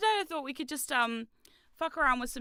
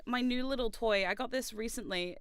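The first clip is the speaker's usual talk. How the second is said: normal talking voice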